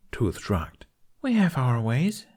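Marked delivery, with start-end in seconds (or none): 1.2-2.3 high, fast, chipper woman; frantic upbeat. A narrator's reading. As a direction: rush the words out excitedly. Tooth answers Jack.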